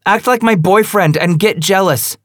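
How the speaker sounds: high, loud mocking challenge; taunting. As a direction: throw it at him like a dare. Female character taunting angrily.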